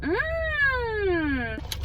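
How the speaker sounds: delighted tasting hum; savoring mmm. Real, not acted. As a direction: savor the sip, pleased. Tasting the pumpkin spice drink.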